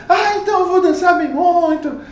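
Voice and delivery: high, bright mocking voice of her; playful imitation of her boast; mock excited woman. Retelling the dancing woman, high mock voice.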